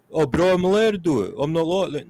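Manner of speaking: high whiny protest; mock whiner. Mocking a hypocrite who objects to protests, high and whiny.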